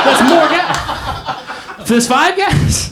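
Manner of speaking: high, slow, disbelieving outburst; incredulous. Retold or imitated. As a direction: voice jumps up in mock outrage. Acts out disbelief at wife.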